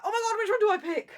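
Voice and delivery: fast, high, loud and laughing; overwhelmed. Retold or imitated. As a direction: gush over too many choices. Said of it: "I'm like".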